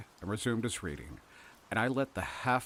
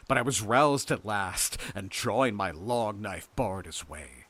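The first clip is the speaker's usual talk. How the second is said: ordinary delivery, nothing marked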